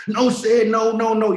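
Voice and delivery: high, loud, frantic pleading; panicked high-pitched comic plea; Kevin Hart voice. Retold or imitated. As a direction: squeal it like Kevin Hart begging. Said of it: "my Kevin, Kevin Hart voice".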